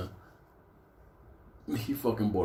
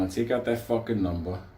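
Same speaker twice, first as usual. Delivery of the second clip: usual speaking voice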